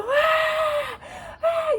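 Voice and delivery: long high wailing ah; labour screams. Retold or imitated. Mother retelling her screams while giving birth, long and high. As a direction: wail through the push. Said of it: "E eu berrava".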